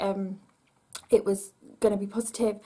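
ordinary talk

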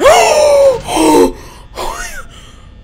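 high startled gasp breaking into a laugh; gasping shriek. Real, not acted. Jump scare, laugh-shout.

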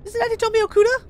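bright, high anime schoolgirl voice; high girl voice. Acted. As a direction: play the young heroine up high. Player voices heroine Mia.

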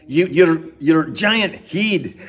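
loud, high mock-Scottish shout; hammed-up Scottish accent, drawn out; bad Scottish accent. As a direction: bellow it in an exaggerated Scottish accent. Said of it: "I can't do that accent".

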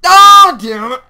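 high, very loud cry of dismay; sudden anguished outburst; frustrated yell. Real, not acted. Tiger escapes the trap.

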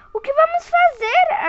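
high, tearful frightened question; little girl asking her parents, near tears; almost crying. Acted. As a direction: ask it on the edge of tears. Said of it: "quase chorando".

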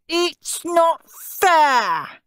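high, very slow wailing howl. A narrator's reading. Steve howls at Henry unfairly. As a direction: drag out the complaint. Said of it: "howled Steve".

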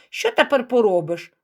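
usual speaking voice

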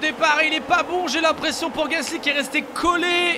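excited race-start play-by-play, high and loud; motorsport commentator. Acted. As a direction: call the start like live TV. (commentateur sportif survolté)

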